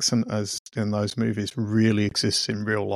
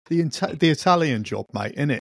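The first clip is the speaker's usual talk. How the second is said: Mockney geezer voice, a little higher; lightly put-on cheeky Cockney; Mockney accent; 3 semitones higher than usual. Echoing his co-host's film quip, light Mockney accent. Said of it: "launch into a Mockney".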